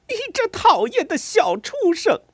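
high, loud, shrill scream of rage; furious shrieking yell. A narrator's reading. Mother screaming at the cruel girl in fury. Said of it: "她冲南希吼道".

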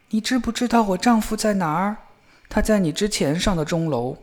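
a touch of urgent worry; worried wife. A narrator's reading. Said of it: "后来她感到很担忧".

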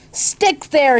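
high storybook yell; angry yell. A narrator's reading. Baker flinging dough at the ceiling, yelling angrily. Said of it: "he yelled at the dough".

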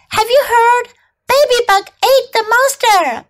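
high-pitched storybook shout; shouting inchworm. A narrator's reading. Inchworm tells everyone the news, shouting. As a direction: spread the news in a high, excited call. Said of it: "He shouted".

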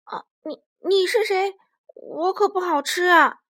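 high, loud panicked yelp; T-rex waking to a stranger, terrified; frightened shout. Acted. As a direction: beg not to be eaten. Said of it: "霸王龙说".

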